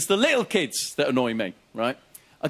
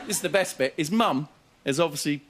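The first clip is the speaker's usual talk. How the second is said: ordinary talk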